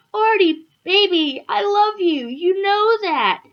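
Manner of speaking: high, drawn-out lovesick plea; pleading lover. Acted. Alfred pleading with his upset lover Artie, high-pitched pleading.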